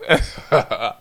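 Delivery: helpless chuckling; real laugh. Real, not acted. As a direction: laugh at your own memory. Laughs at own story.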